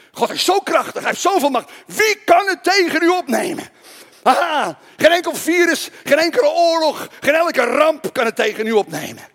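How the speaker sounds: loud, high, triumphant proclamation; rousing preaching. Real, not acted. Preacher assuring the congregation nothing can oppose God, rousing proclamation. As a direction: build the crowd's faith with full force. Preaches God's power at full force.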